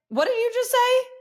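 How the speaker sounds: high, loud parental challenge; confronting mother. Retold or imitated. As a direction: imitate a mum calling out her kid sharply. Mother confronts son in retelling.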